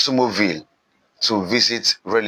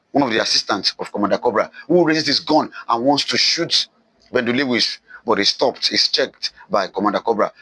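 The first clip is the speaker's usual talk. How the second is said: ordinary delivery, nothing marked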